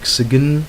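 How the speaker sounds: sigen said as two syllables with a hard G; mock mispronounced sign; wrong sign with hard G. Shown as a demonstration. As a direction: sound the G in sign like sig-en. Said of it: "you don't say sag-, sigen".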